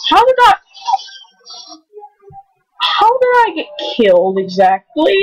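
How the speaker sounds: normal talking voice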